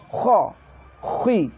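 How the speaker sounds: "khui" with rounded, pouted lips; lips pouted forward. Shown as a demonstration. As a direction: keep the pout of "Kho" going into "khui". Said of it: "ke depan, moncong". ("khui" dengan mulut moncong ke depan)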